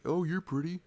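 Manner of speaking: high, put-on voice of a shallow guy; mock shallow guy. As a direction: toss it off like a shallow player.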